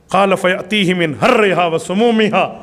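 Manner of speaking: ordinary talk, nothing marked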